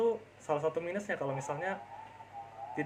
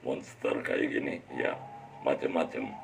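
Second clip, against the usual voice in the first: monster voice; slower than usual. Shown as a demonstration. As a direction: drop into a deep monster voice. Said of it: "jadi sosok pahlawan atau mungkin jadi sosok".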